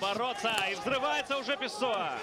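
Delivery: high, fired-up call of the action; excited commentary. Real, not acted. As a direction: erupt with excitement at the scramble. Co-commentator erupts at scramble.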